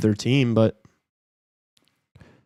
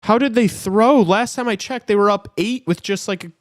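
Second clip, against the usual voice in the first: much higher, pitched-up disbelief; can't believe they threw the lead; incredulous; 11 semitones higher, 5 dB louder than usual. Real, not acted. Fan asks friend how the Lakers blew the lead, incredulous.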